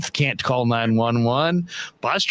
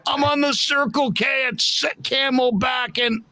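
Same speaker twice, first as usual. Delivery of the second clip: panicked caller; 11 semitones higher than usual. Retold or imitated. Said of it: "couldn't quite get out the address".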